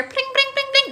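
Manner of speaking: high pinging pling noises; ping each pling up high; pling sound. Retold or imitated. The kid tapping at their door, high plings.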